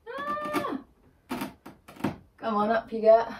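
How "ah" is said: sharp high yelp. Real, not acted. Something slips off wall.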